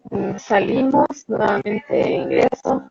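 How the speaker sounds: normal talking voice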